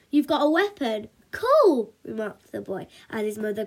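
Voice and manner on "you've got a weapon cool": excited, higher and louder on COOL; thrilled little boy admiring a weapon; wowed, with a big loud "cool"; impressed young boy. A narrator's reading.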